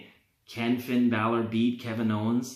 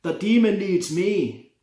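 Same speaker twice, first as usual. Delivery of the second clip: put-on lightly done Irish accent, rising higher and louder; bad Irish accent; 4 semitones higher, 4 dB louder than usual. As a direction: boast it in a hammed-up Irish accent. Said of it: "That's a terrible Irish accent".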